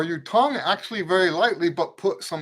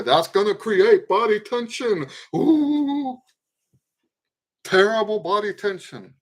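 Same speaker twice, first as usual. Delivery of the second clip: hammed-up dread, making fun of the warning; mock alarm; 5 semitones higher, 5 dB louder than usual. Teacher imitating those who fear body tension, mock alarm.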